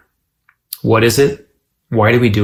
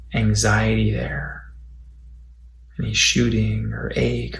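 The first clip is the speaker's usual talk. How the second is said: softer, unhurried meditation voice with long pauses; guided body scan meditation; 5 dB quieter than usual. Acted. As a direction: guide them quietly through the body, pausing between phrases.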